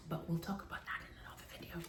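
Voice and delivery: whispered aside. Real, not acted. Teasing aside to viewers.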